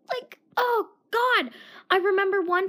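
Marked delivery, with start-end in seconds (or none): 0.1-1.5 high, slow mock whimper. Real, not acted. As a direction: whine it out like you're scared. Mock distress over trucks.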